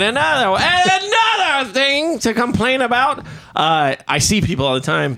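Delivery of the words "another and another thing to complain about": exaggerated complaint. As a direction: ham up the grievance.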